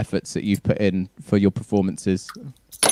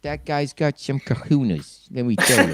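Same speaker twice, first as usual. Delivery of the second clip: put-on Boston tough guy accent; 3 semitones higher, 4 dB quieter than usual. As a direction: lay on a thick Boston accent. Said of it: "That's a pretty good Boston accent".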